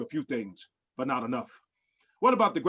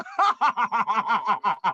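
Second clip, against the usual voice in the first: villain laugh; 4 semitones higher, 3 dB louder than usual. Acted.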